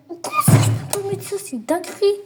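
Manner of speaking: high loud disbelieving rant, fast; fast, loud indignant outburst; outraged shout. Real, not acted. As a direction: blurt out the outrage quickly. Outraged at missed shot.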